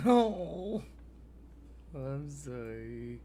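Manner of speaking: usual speaking voice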